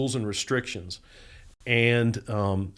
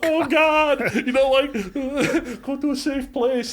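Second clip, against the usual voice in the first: very high, loud, comic panic; mock terror; 6 dB louder than usual. New inmate facing huge man, hammed-up panic. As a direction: squeal it in hammed-up fright, half laughing. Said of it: "I'm scared to death".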